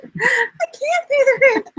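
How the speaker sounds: high, slow wailing damsel; damsel in distress. Acted. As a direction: wail it like a helpless heroine. Melodrama damsel reply.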